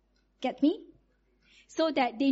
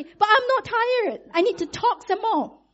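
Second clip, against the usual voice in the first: put-on wide-awake child; 6 semitones higher, 3 dB louder than usual. Daughter protests to her tired mom, high and insistent. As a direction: plead it like a child who won't sleep. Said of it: "Then she say".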